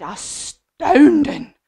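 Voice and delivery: long, drawn-out loud amazement; astounded Gruffalo. Acted. Gruffalo surprised by the mouse, drawn-out astonishment. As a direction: stretch it out slowly, swelling in wonder. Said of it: "very surprised at this point".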